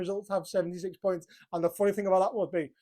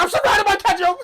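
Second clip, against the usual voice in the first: high, loud mock panic; hammed-up terrified cry; panicked shout; 13 semitones higher, 9 dB louder than usual. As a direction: yell it like you're trapped. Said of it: "And Cam's like".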